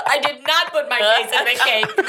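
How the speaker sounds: high, laughing protest; laughing denial. Real, not acted. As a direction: deny it through laughter.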